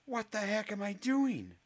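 a panicked cry inside his head; high alarmed inner voice; inner scream. Acted.

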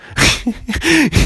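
high-pitched imitated laugh; imitating a high laugh. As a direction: mimic his giggly laugh. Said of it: "And he was just laughing".

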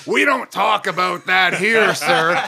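loud, slow mock Southern yell; yelled Southern rebuke. Host imitating crowd shutting down his vaccine joke, yelling. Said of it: "they yelled at me".

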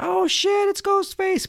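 very high, loud alarm; mock panic. Girlfriend suddenly seeing Ghostface, mock panicked. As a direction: yelp it in put-on fright. Acts out shock at killer.

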